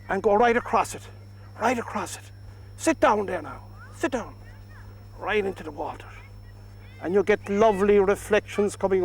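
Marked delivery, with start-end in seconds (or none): 1.6-2.3 softly whispered instruction. Shown as a demonstration. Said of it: "whisper to it". 2.8-6.1 hushed, coaxing murmur to the painting; whisper. Shown as a demonstration. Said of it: "whisper to it".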